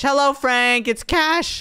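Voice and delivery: high, singled-out hail; calling out greeting. Acted. Cash showing off friend Frank to sister, high eager call. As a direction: call to a friend, pitch lifted.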